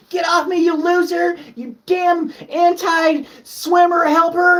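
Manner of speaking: high shouted mock rant, slowing at the end; mocking ungrateful swimmer. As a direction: yell it like a brat pushing the rescuer away. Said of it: "and they're like".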